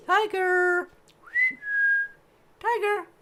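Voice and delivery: high, loud call to a pet. Real, not acted. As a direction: call the cat from across the room. Calling the cat for treats.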